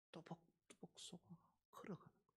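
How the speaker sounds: very soft, breathy near-whisper; whispering the cow's plodding walk; hushed. Acted. Hushed close of the image.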